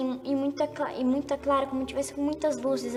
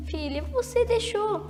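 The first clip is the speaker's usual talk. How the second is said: tearful aunt; 6 semitones higher than usual. Retold or imitated. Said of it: "E ela começou a chorar".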